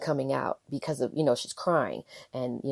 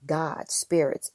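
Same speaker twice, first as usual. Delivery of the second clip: ordinary talk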